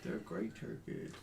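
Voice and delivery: mock-solemn echo. Mock echo of game master's phrase.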